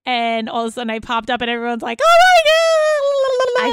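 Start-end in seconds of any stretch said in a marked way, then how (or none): 2.0-3.7 mimicking the girls going wild; excited squeal. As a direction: squeal it like the whole group at once. Mimics everyone's excited reaction.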